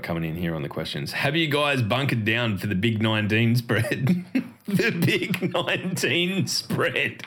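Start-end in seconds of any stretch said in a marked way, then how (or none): 4.3-7.2 slow, high giggling repeat; laughing. Real, not acted. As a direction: drag the words out through laughter. Cracks up at own euphemism.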